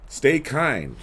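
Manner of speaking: ordinary delivery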